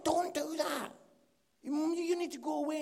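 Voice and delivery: high, loud, alarmed urging; urgent scolding. Retold or imitated. Gentleman stopping Mark at the door, urgent scolding. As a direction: send him off to rethink, loud and high.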